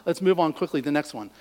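ordinary delivery

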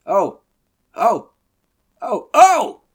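high, loud yelps of terror, mounting to a scream; short, sharp cries. Acted. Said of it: "a succession of short, sharp cries".

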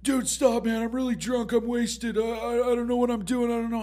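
high, loud whiny begging; pleading drunk. Retold or imitated. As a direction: beg for mercy in a squeaky voice. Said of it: "he's like".

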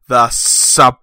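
loud, drawn-out title announcement; segment announcer. Pretend. Reviewer introducing the next section, big announcer delivery. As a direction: boom out the heading, pausing before the last word.

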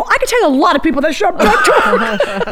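cheeky retort, laughing through it; landing the zinger while laughing; laughing comeback. Retold or imitated.

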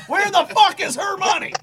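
angrier demand. Acted. Hero demanding the old lady's money in a rising angry demand. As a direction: escalate the demand, raise the pitch. Repeats it more forcefully.

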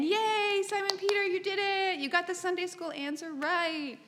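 hammed-up cheer for the right answer; mock cheering. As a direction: cheer him on like a proud kid. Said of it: "we as modern readers might be saying".

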